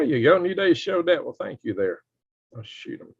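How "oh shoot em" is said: whispered curse. Real, not acted. Loses chat.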